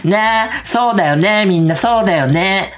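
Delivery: high, drawn-out pleading for agreement; Ryota. Retold or imitated. Streamer urging everyone to agree, high insistent impression. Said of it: "で、りょうたもね、".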